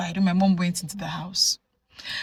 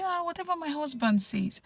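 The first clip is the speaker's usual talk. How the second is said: meek wife; 9 semitones higher, 4 dB quieter than usual. Retold or imitated. Quiet wife voiced in story.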